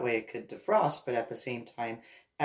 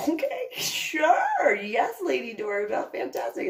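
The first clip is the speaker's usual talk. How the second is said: laughing reply; 11 semitones higher, 4 dB louder than usual. Retold or imitated. Guy answering Lady Dorabelle, laughing and much higher. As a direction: crack up while agreeing. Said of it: "they're just".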